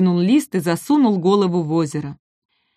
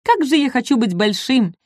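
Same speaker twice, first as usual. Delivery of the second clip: high, plaintive wish to be big; wistful little dinosaur; 4 semitones higher than usual. Acted. Маленький Ком мечтает стать большим, жалобно. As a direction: sigh for being bigger, in a high small voice. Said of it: "сказал он".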